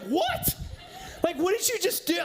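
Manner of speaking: high, incredulous what; jaw-dropped, can't believe what he heard; stunned disbelief. Retold or imitated. Twelve-year-old son reacting to dad's sudden British accent, high and incredulous.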